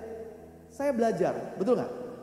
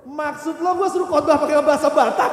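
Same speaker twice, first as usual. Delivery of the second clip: loud, high outraged shouting; hammed-up indignant outburst; acted protest; 7 semitones higher, 12 dB louder than usual. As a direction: protest at the top of the voice. Acted protest at preaching in Batak.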